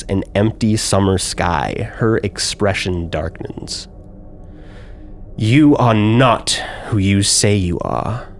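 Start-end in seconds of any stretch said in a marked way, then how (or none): 5.4-8.3 menacing drow noble. Acted. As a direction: lay out each accusation like a sentence passed. GM voices drow woman.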